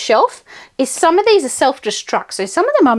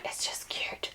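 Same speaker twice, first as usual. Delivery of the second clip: whispered gushing aside; hushed aside; 22 dB quieter than usual. Real, not acted. Vlogger showing off a special book, hushed aside. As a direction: drop right down to a tiny whisper.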